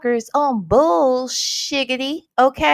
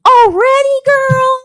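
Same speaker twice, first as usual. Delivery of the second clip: surprised delight; 11 semitones higher, 8 dB louder than usual. Real, not acted. Surprised at a gifted membership.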